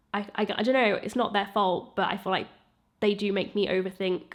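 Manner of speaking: normal talking voice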